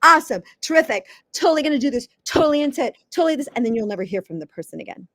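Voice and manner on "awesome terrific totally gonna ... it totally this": high, bubbly over-the-top enthusiasm; mock upbeat West Coast gush, higher pitched; gushing California. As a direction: gush like a Silicon Valley fan. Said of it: "everything's like".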